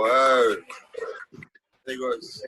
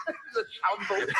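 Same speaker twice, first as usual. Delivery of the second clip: laughing; 3 dB quieter than usual. Real, not acted. Laughing at own story.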